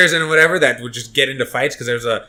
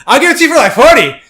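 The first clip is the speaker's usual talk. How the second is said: very high, loud yelled offer; mock vendor shriek; 13 semitones higher, 9 dB louder, faster than usual. Third vendor shouting a cheaper price, high loud mock yell.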